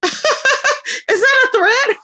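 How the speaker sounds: high, slow laugh through the words; laughing in disbelief. Real, not acted. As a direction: laugh it off, stunned it could be a threat. Laughs at a supposed threat.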